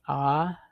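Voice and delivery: ah held open with a slight rise; open ah sound. Shown as a demonstration. Teacher showing the A H sound, open rising ah. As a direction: say ah as in "father". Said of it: "makes the A H sound".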